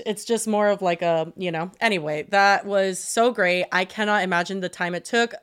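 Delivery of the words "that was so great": ordinary talk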